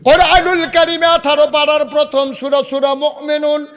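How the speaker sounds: high, ringing scripture recitation; full-voiced preaching. Real, not acted. Preacher cites the Quran to the congregation, full-voiced preaching. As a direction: declaim it high, stretching the verse endings.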